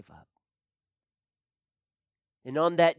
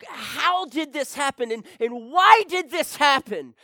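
loud, high anguished shouting; angry cry; 8 semitones higher, 8 dB louder than usual. Retold or imitated. Grieving father shouts his questions in anger. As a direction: hurl the questions out in rage. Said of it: "And I was angry".

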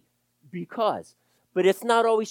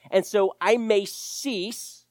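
usual speaking voice

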